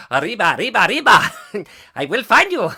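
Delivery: loud, hammed-up Mexican accent; high, laughing mock Mexican accent. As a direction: shout it as a cartoon Mexican. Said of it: "better with a Mexican accent".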